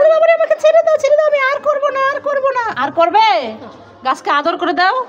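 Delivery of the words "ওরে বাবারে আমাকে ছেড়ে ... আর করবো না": high hammed-up begging; mock pleading. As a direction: play the scolded one begging to be let go. Mother acts child begging. (উঁচু গলায় নকল অনুনয়)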